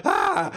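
a quick yelp at the spider; mock scream. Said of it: "and I'm like".

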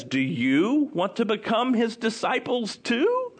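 high, teasing question; making fun. Acted. Healed man to the religious questioners, teasing them. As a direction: ask it as a mock invitation. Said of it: "He starts making fun of them".

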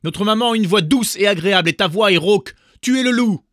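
high, loud and fast accusing; shouting kids. A narrator's reading. Young goats yelling at the wolf that he is found out.

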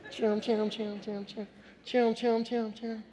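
humming chum chum like a lightsaber; playful lightsaber noise; lightsaber hum. Retold or imitated. Kid mimics lightsaber hum.

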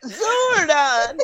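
very high wailing robot; high cartoon robot plea; Alpha from Power Rangers. Shown as a demonstration. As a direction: cry out like a distressed little robot. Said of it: "from Power Rangers".

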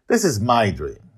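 short, loud, curt statement; abrupt. A narrator's reading. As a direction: snap the line off quickly. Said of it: "Justin says with abruptness".

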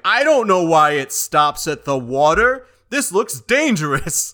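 a lightly played New Yorker; New York accent. Extra on the subway in a mock New York accent.

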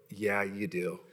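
low, soft mock-timid admission; hushed, low comic confession; quiet agreement. Husband's cautious agreement retold.

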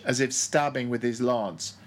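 plain reading voice

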